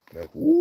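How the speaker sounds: loud high-pitched woo howl; imitated howl. Shown as a demonstration. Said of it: "That wasn't a very good example".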